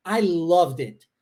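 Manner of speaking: "I loved it" slow, the d of "loved" joined onto "it"; linked d. Shown as a demonstration. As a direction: link loved and it, slowly. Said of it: "terminação do som de D". ("I loved it" ligando o D ao I, devagar)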